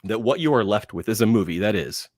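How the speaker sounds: ordinary talk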